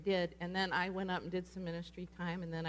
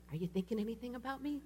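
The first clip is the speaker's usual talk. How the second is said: higher, quick, mock-worried asking; anxious question; 5 semitones higher, faster than usual. People come up to the prophetic person, mock anxious. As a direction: ask it fast and pitched up, imitating them.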